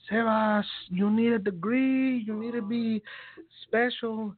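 high, loud imitation of a South American mom's accent. Mom urging her son to get a degree, imitated accent. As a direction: scold like mom in her accent, drawing out the words. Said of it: "this is my mom's accent".

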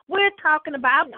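ordinary talk, nothing marked

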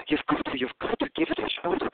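high-pitched imitation of a friend insisting; insistent actress friend. Actress friend pushing him to try comedy, high insistent imitation. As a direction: climb very high as the pleading friend. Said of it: "She goes".

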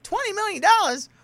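very high, loud squeal of disbelief; mock astonishment. Cop shown the civil suit, squealed mock shock. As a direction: screech the figure as a stunned cop. Cops learn of the huge suit.

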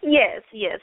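usual speaking voice

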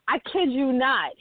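ordinary delivery

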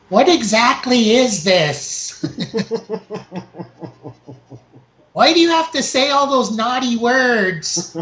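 mom voice. Retold or imitated. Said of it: "she's like".

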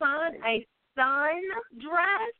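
Sun, a sundress? drawn out slowly, stressing Sun; slow correction. Shown as a demonstration. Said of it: "I didn't quite hear your accent".

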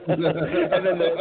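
ordinary delivery

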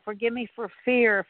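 ordinary delivery

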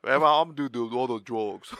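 bad Austrian accent mashed with a Stallone slur; hammed-up, botched Austrian action-star accent; Arnold Schwarzenegger. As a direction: attempt an Austrian strongman accent, badly. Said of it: "That was like Arnold Schwarzenegger mixed with".

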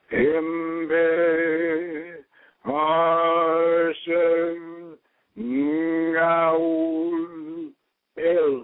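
solemn chanted Enochian calls; Enochian invocation. Real, not acted. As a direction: call out each name as a holy word. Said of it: "do it in Enochian".

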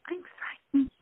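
frightened whisper. Shown as a demonstration. Someone frightened confessing fear, in a whisper. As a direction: whisper it like a scared person. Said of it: "If someone says".

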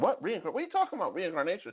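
high, baffled disbelief at reincarnation; incredulous retold what. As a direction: act out your own shocked reaction. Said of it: "I'm like".

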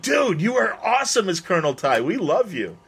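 high, swooping fanboy praise; imitated fan adoration; gushing fans. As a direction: gush at a favorite actor. Fans gushing at actor, retold.

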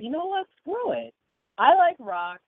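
high, fired-up defiance; defiant outburst. Retold or imitated. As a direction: throw off the doubt, fired up. Retold defiant self-talk.

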